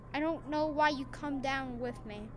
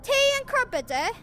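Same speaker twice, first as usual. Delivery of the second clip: higher, louder hammed-up British tea line; mock British accent; 9 semitones higher, 8 dB louder than usual. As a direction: lean into a silly British gentleman. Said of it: "I'm not British".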